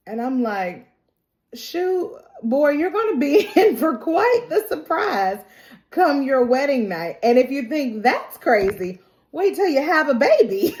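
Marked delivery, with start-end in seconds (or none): 1.5-10.7 high-pitched, laughing mock warning; amused teasing warning. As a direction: tease a naive girl, breaking into laughter. Narrator teasingly warns the character.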